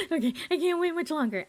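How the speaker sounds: high, fast, eager mock voice; eager boyfriend. Boyfriend waiting for his fiancée to come out, high and eager. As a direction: rush it out, barely able to wait. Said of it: "And he's like".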